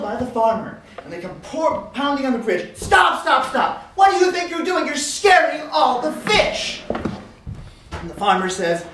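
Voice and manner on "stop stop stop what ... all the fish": high, loud scolding yell; angry shout. Acted. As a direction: bellow at them, stretching the last words. Fisher yells at the runners.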